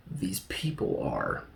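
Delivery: whispered, drawn-out reveal; hushed reveal. Shown as a demonstration. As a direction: drop to a slow, soft whisper. Reveals hidden people, hushed.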